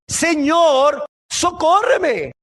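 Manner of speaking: high, drawn-out plea; acted mother's cry for rescue; crying out for help. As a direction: call out desperately, stretching the last word. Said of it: "una madre clamándole".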